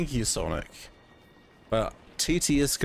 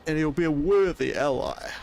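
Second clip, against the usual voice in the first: higher, louder servant voice; a servant's pitch to his master, higher and louder; eager scheming servant; 5 semitones higher, 4 dB louder than usual. Acted. Servant pleading to dark lord.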